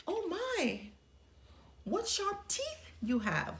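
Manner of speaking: put-on a child suddenly uneasy at granny's teeth; Little Red, alarmed. Little Red talks to disguised wolf.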